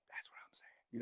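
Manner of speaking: quick breathy whisper, no voice; whispered aside. Real, not acted. Host whispering an aside to his listeners. Aside after quoting himself.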